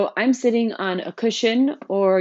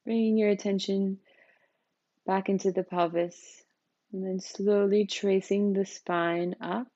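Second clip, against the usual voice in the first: softer, unhurried voice, settling listeners into the body; guided meditation; 4 dB quieter than usual. Real, not acted. Teacher leading newcomers through a seated practice, calm meditation guidance.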